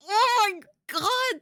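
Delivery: high loud burst of amazement; excited outburst. Real, not acted. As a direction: blurt it out, thrilled. Sudden excited outburst watching video.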